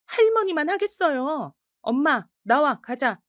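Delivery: high snap then a slow firm call; curt cheeky comeback. Acted. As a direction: cut her off and lead mom out. Granddaughter retorts and leaves.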